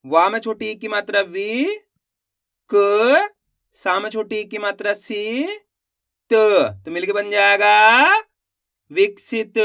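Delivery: walk students through joining each syllable; step-by-step reading demo. Shown as a demonstration. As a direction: build विकसित syllable by syllable, with a loud क and a stretched-out जाएगा. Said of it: "देखो किस तरीके से". (विकसित को एक-एक अक्षर जोड़कर धीरे पढ़ाना)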